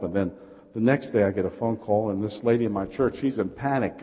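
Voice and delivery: ordinary delivery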